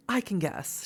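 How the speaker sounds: normal talking voice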